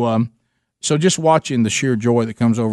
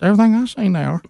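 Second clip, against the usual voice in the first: imitating Gary, pitched higher; Gary's voice; 8 semitones higher, faster than usual. Retelling what Gary said to Rick, higher imitated voice. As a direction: put on the friend's higher twang.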